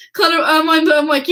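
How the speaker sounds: ordinary talk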